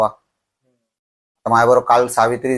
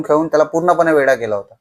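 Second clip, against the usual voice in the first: normal talking voice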